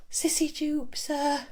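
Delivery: a shy pupil speaking up; young girl addressing her teacher; timid schoolgirl. Acted.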